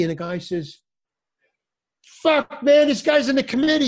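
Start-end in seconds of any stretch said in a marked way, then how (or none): 2.2-3.9 much higher, louder, astonished; sudden recognition. Retold or imitated. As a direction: realize who he is in shock. Dealer suddenly recognizing the comedian.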